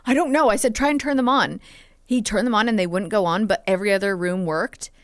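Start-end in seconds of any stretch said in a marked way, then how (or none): 0.1-0.5 a louder, higher retold panic in the dark; retold panicked cry. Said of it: "I just screamed for my partner". 0.8-1.6 louder, higher retold plea to her partner; retold urgent call. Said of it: "I just screamed for my partner".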